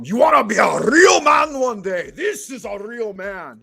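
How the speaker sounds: put-on thick Austrian accent, preaching to kids. Arnold lecturing kids at the beach, Austrian accent. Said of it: "yelling it in a thick Austrian accent".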